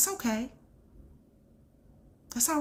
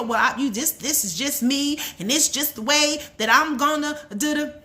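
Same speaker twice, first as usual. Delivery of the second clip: higher-pitched, insistent mock excuse; mock defensive excuse; 5 semitones higher, 4 dB louder than usual. Speaker mimicking a listener's excuse, defensive. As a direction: imitate someone brushing it off, pitched up.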